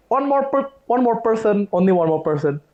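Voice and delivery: high-pitched call that steps down; lightly voiced bus conductor. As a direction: imitate a conductor announcing one last place. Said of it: "the conductor said".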